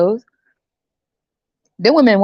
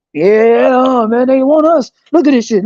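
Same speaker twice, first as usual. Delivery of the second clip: loud, raised, mock-thrilled bragging; pumped up; 4 semitones higher, 3 dB louder than usual. Dude bragging that women want him, pumped up. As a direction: crow like a hyped-up dude. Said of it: "they be getting pumped up".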